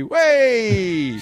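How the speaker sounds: long, high drawn-out cheer; happy cheer. Retold or imitated. Comedian greeting a stumbling drunk, happy drawn-out cheer. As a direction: let out an obligatory merry whoop. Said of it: "like a, like a happy".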